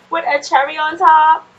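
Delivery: firm playful insistence; insistent. Real, not acted.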